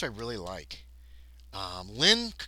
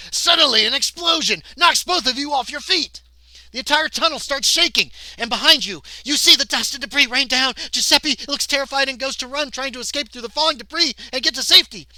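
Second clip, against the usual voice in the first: high, shouted action narration; urgent story narration; 13 semitones higher, 15 dB louder than usual. A narrator's reading. Game reader announcing a tunnel explosion, loud urgent narration. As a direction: belt out the disaster at full pitch.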